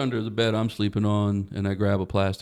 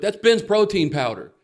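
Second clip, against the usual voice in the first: shocked realization; 8 semitones higher, 6 dB louder than usual. Retold or imitated. Realizing the powder is protein.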